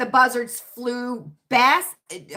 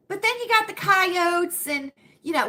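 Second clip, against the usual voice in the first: usual speaking voice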